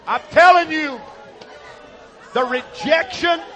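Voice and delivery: preaching. Real, not acted. Preaching emphasis.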